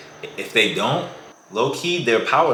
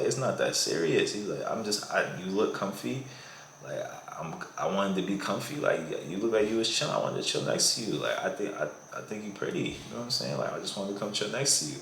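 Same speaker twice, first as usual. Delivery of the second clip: soft, unbothered guy brushing it off; laid-back guy softly shrugging off her complaint; calm chill guy; 8 dB quieter than usual. Retold or imitated. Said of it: "He stays completely calm".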